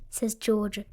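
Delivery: usual speaking voice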